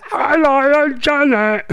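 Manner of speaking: high caricature greeting; Janet Street Porter. Acted. Host winding up a colleague with an impression, high caricature voice. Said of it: "Janet Street Porter".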